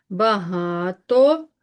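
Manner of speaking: багато very slow, with a long ГА; stress on second syllable. Shown as a demonstration. Teacher marking stress for pupils, stressed syllable stretched. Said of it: "е ненаголошений".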